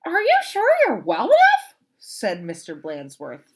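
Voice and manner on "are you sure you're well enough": high, loud fussy principal; principal's voice. Acted. Principal checking on the returning teacher, high and fussy.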